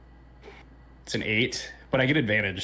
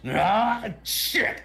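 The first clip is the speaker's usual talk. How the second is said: high exasperated growl into a curse; frustrated; 11 semitones higher than usual. Acted. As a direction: burst out in acted frustration. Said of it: "he's frustrated at the situation".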